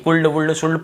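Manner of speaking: कुल्ड, वुल्ड, शुल्ड with an audible L before D; L wrongly sounded. Shown as a demonstration. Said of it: "पढ़ना ही नहीं है".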